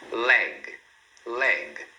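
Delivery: LEG as one syllable stopping on G; clean final consonant. Shown as a demonstration. Teacher models word for repeating, correct pronunciation. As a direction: say "leg" with no vowel after G. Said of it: "Olha outro exemplo".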